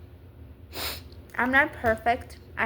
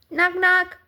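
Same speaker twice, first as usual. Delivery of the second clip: higher, louder teasing visitor voice; allergies talking; 6 semitones higher, 8 dB louder than usual. Pretend. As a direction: play the allergies as a smug pest knocking at the door. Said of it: "and now for some reason they're like".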